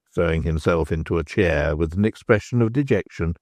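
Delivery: ordinary delivery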